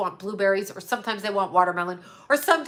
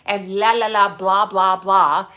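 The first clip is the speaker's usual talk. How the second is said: mocking blah blah; 5 dB louder than usual. Real, not acted. Dismissive singsong.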